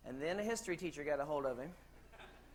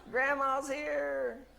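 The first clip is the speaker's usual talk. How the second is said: high, loud, drawn-out announcement; calling out; 8 semitones higher, 6 dB louder, much slower than usual. Acted. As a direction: stretch it out like a playful arrival call. Acted arrival announcement after joke.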